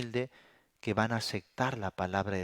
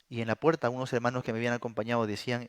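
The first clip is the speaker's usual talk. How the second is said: ordinary delivery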